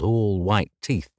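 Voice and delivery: ordinary delivery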